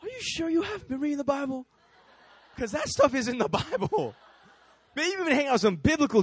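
high, amused teasing through laughter; laughing, incredulous tease; laughing disbelief. Retold or imitated. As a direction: chuckle it as a friendly jab. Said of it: "I'm like".

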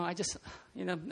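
soft, trailing-off fumble; halting stammer. Acted. Tom Cruise as his wife tears up, soft halting stammer. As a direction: start softly and lose the words. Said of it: "like, stuttering".

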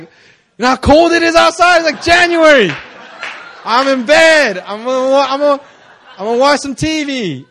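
loud, high comic outburst; hammed-up exasperated complaint; indignant protest. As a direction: protest loudly at being dragged out. Acted protest at a winter favour.

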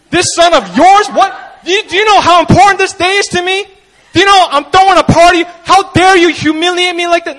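high, loud hammed-up fury; mock indignation. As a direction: rant like an offended host. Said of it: "I'd be like".

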